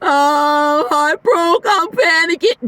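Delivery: high, whiny mock panic; a mild mock of someone in a tizzy. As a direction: play the panicking person, hammed up. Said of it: "what are you gonna do".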